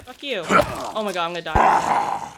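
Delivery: loud panicked gamer yelling; scared horror game streamer. Real, not acted. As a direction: panic as the monster closes in. Monster attacking in game.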